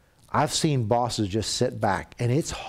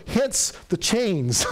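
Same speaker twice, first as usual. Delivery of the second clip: deadpan punchline; 7 semitones higher, much slower than usual. Retold or imitated. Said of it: "She goes".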